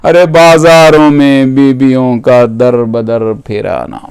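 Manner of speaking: low, sinking mournful cry; majlis lamentation. Acted. Preacher mourning women paraded through bazaars, low closing lament.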